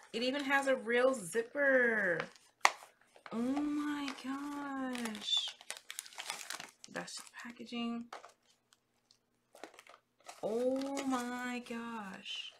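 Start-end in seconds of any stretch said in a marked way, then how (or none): 3.3-5.3 slow breathy oh my gosh, drawn out in awe; awed gasp. Real, not acted. Unboxing a handmade gift from a friend, slow awed gasp. 10.4-12.5 slow breathy oh my gosh, drawn out in awe; awed gasp. Real, not acted. Unboxing a handmade gift from a friend, slow awed gasp.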